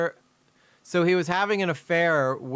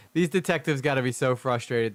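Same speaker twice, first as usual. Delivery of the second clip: normal talking voice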